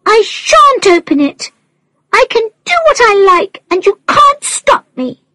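put-on high, fast bratty child voice; defiant child shouting. A narrator's reading. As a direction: taunt like a stubborn little girl. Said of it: "Ellie shouted back".

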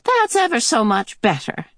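high, pleased ah; old woman sigh of relief. Acted. Mrs Watson relieved of her boot, high pleased ah. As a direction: let out a satisfied ah.

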